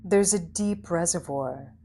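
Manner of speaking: calm guided visualization voice with long pauses; unhurried, soothing instructions, leaving space after each phrase; guided meditation. Acted. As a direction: gently lead a listener into stillness, phrase by phrase.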